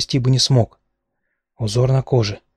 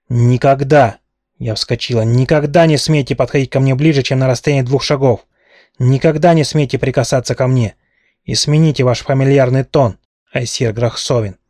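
loud, commanding tirade; outraged royal reprimand; furious rebuke; 4 dB louder than usual. Acted. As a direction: put him firmly in his place. Princess furiously rebukes suitor.

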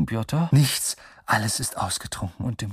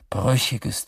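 usual speaking voice

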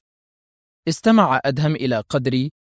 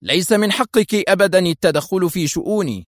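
higher, indignant rebuke; angry interruption; 6 semitones higher than usual. A narrator's reading. Husband cuts off his wife's claim, angry. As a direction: cut in angrily. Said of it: "قاطعها في غضب".

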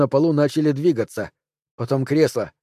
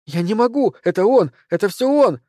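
screaming; 8 semitones higher than usual. A narrator's reading. Anne-Cécile screams at Jean Robin that it is him. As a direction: scream in panic and point the blame. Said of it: "закричала она".

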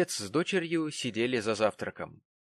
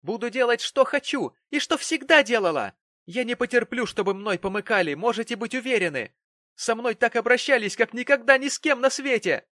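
very high, loud defiant tirade; shrill, hysterical protest; piercing shriek; 11 semitones higher, 7 dB louder than usual. A narrator's reading. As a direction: screech it in outraged fury. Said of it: "повышая голос до пронзительного визга".

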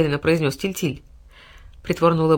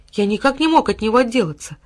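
usual speaking voice

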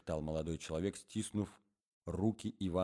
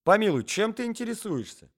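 much higher, louder reproach; scolding wife; 9 dB louder than usual. Acted. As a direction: scold the husband.

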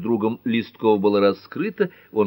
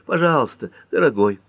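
higher, pressing plea; urgent pleading; 5 semitones higher, 5 dB louder than usual. A narrator's reading. Woman pushing away her lover, pleading. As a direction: plead with him to stop.

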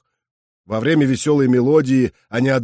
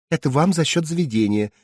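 sweet, slightly higher waitress voice; 4 semitones higher than usual. Acted. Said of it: "милым голосом сказала она".

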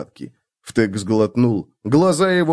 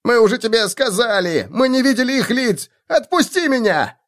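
high indignant cry; shouting halfling; 12 semitones higher than usual. A narrator's reading. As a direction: yell it at the dwarf. Halfling pleads.

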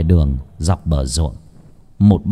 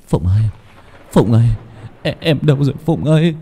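panting, building into a high, loud wail; a fiancé gasping and crying out for his dead bride; breathless broken voice; 9 semitones higher, 5 dB louder than usual. Acted. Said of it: "Tân thở hổn hển, giọng đứt quãng".

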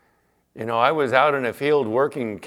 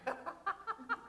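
short laugh; 18 dB quieter than usual. Real, not acted. Laugh during fishing story.

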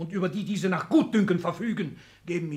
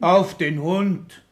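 slow, loud and cutting, louder; angry outburst; 3 semitones higher, 8 dB louder, much slower than usual. Acted. Father to his children, a slow loud angry outburst. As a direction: spit it out slowly in contempt.

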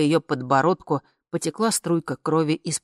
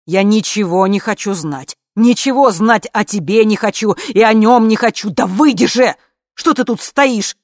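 loud, raised angry rant; loud, agitated angry tirade; furious outburst; 4 semitones higher, 8 dB louder than usual. A narrator's reading. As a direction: shout them out of the room. Furious order to get out.

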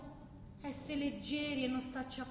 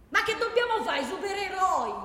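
loud, much higher, scoffing with a laugh; mocking outburst; 9 semitones higher, 9 dB louder than usual. Scoffing at the idea of being superheroes, loud and mocking.

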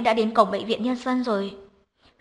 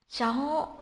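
kinh ngạc; 3 semitones higher, 5 dB quieter, slower than usual. A narrator's reading. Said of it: "Khả Nhi kinh ngạc".

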